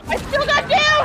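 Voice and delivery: high, loud gleeful yell; excited shout. Real, not acted. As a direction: shout it out in triumph. Exclaiming in the game.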